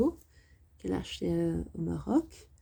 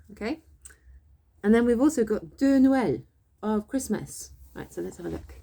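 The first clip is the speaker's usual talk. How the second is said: ordinary talk, nothing marked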